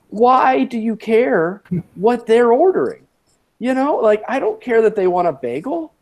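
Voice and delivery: baffled, impatient viewer's gripe; exasperated. Real, not acted. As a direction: plead in mild exasperation at pointless detail. Mock plea to the film-maker.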